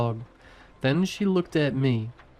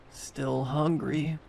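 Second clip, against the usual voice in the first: put-on slow, soft rasp of a dead girl; 3 semitones higher, 4 dB quieter, slower than usual. A narrator's reading. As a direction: drag the words out, quiet and rasping. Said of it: "she rasped".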